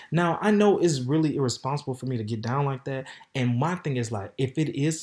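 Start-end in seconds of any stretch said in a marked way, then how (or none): none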